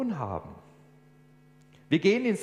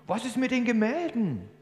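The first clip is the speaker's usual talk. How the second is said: much higher, a different indignant voice; outraged bidder; 11 semitones higher than usual. Acted. Bidder to auctioneer, higher indignant outcry. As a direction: protest from the crowd in disbelief.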